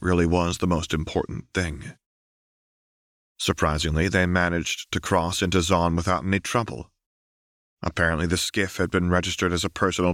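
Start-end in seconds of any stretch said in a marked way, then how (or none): none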